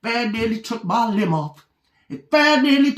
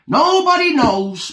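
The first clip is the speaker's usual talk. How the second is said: higher and louder repeat; emphatic repeat; 5 semitones higher, 5 dB louder than usual. Acted. The character insists to the listener with a loud repeat. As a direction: punch it out the second time.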